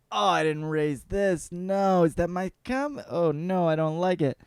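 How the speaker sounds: a hint of a horrified parent; mock parental dismay. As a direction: wail it lightly in disgust. Parent voiced, dismayed by grown kid.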